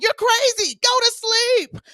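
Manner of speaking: high, loud scoff, slow on go to sleep; exasperated retort. Retold or imitated. Host mocking Julia with a high, loud, slow retort. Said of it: "I'm over here like".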